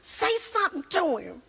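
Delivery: high, urging; egging on. Retold or imitated. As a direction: egg him on, high and eager. Wife urging husband.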